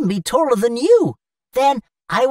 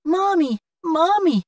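put-on high little-boy voice calling out; a small boy pleading for food; childlike whine for mommy; child calling mommy; 6 semitones higher than usual. Hungry child calls mother.

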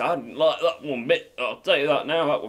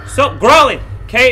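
loud, raised-pitch yell; exasperated shout; 7 semitones higher, 9 dB louder than usual. Real, not acted. Player ordering the creature to stop growling, exasperated shout. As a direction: shout it down, rattled.

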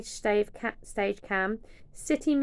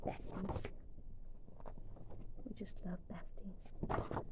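slow soft whisper; fond whisper; 13 dB quieter than usual. Real, not acted. Fan cooing over the best friends, whispering. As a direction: whisper it fondly.

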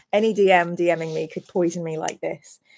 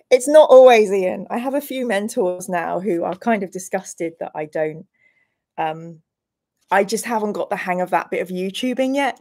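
normal talking voice

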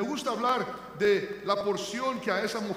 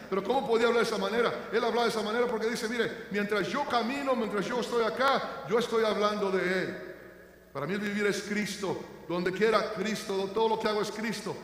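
raised, louder preaching voice building to a cry; full-force preaching; 3 semitones higher, 4 dB louder than usual. Acted. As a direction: proclaim the resurrection to the congregation. Preaching on Paul's words. (predicador proclamando con fuerza la resurrección)

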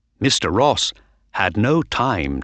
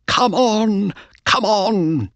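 excited urging; 12 semitones higher, slower than usual. A narrator's reading. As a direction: urge the horse on, voice way up. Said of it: "cried Mister Ross".

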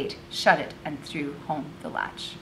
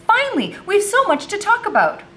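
very high, loud excited burst; eager greeting; 7 dB louder than usual. A narrator's reading. Boy finally getting to talk to friend, eager. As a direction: burst out with relief at finally meeting.

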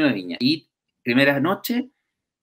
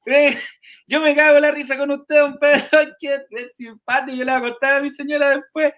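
very high amused driver; lively, laughing chatter; driver's voice; 12 semitones higher, 5 dB louder than usual. Retold or imitated. Uber driver laughing at Pedro, voiced very high. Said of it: "el chofer".

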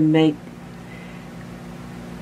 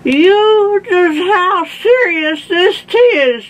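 very high, loud, slow moan; hammed-up toothless old man's lament; moaning Grandpa; 13 semitones higher, 6 dB louder, slower than usual. A narrator's reading. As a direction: wail the complaint with slurred words. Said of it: "moaned Grandpa".

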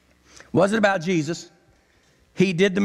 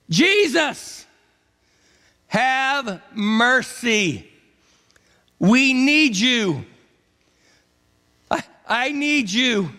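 begging cry; 5 semitones higher, much slower than usual. Acted. Lepers begging Jesus from afar.

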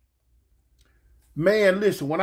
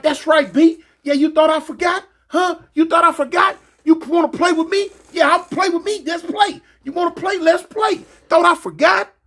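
high, raised taunts, acted out; gloating, higher-pitched retold challenge; taunting; 8 semitones higher, 4 dB louder than usual. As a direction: taunt them with challenging questions. Acts out taunting the victim.

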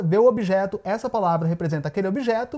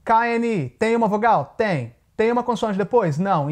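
ordinary delivery

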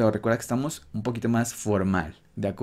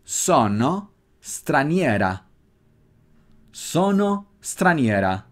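sono straniera slow and drawn out; slow drill of the feminine form; patient teacher model; slow model phrase; 3 semitones higher, slower than usual. Shown as a demonstration. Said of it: "Cuando es en femenino".

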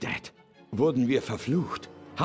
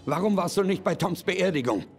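upset; 7 semitones higher than usual. Acted. Son confronts cold father.

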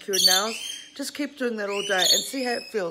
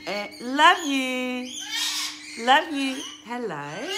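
slow, higher and louder loving coo; cooing to bird; 4 semitones higher, 3 dB louder, much slower than usual. Real, not acted. Owner coos love you to her parrot, tender and slow.

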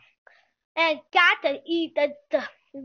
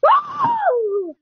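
excited whoop; 6 dB louder than usual. Real, not acted. Touchdown celebration while gaming.

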